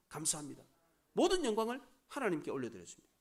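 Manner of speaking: low, soft, humble thanks to the Lord; humble confession. Pretend. Antipas in heaven humbly confessing to the Lord, low and soft. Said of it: "이런 고백은".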